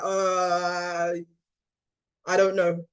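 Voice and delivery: drawn-out, stalling "uh"; mock blank uncertainty; acted cluelessness. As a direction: play a stumped shop owner, hesitating. Acting a clueless shop owner.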